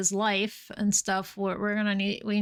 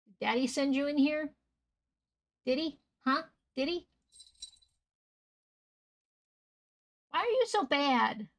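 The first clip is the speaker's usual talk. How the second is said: scolding the cat; 4 semitones higher than usual. Real, not acted. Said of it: "I have to yell at the cat".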